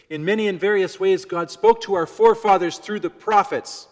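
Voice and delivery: booming proclamation. Retold or imitated. God speaking through the prophets to forefathers, booming. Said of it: "You can probably imagine how that sounded".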